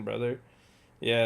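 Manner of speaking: normal talking voice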